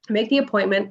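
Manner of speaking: ordinary talk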